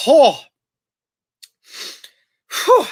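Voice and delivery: sharp high gasp, then whew; relieved sigh. Real, not acted. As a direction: exhale after the last gulp. Finishing the can.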